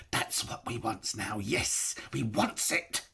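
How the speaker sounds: a hint of a greedy creature; longing, covetous; Gollum, craving. A narrator's reading. Gollum craving his ring, lightly voiced.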